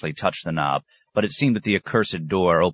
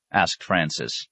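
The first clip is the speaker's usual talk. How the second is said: ordinary delivery, nothing marked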